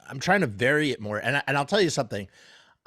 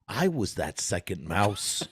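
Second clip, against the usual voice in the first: Christopher Walken impression; 7 semitones lower, 5 dB quieter, slower than usual. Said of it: "Try to do Christopher Walken".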